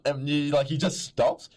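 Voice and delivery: ordinary talk, nothing marked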